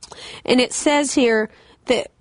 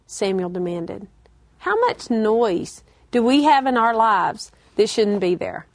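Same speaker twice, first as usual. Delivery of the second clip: ordinary talk, nothing marked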